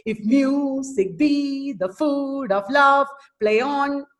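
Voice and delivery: slow chanted line, stressing "mu", "be", "food", "love", "on"; almost sing-song weak-strong beat, holding "food" and "love"; iambic stress. Shown as a demonstration. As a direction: exaggerate every second syllable, as in "music BE". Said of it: "I'm showing you the stress".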